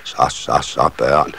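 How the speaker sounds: low, soft hushing; soothing mother. A narrator's reading. Nissemor calming children tugging at Andersen, soft and soothing. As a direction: settle the children gently, voice dropped.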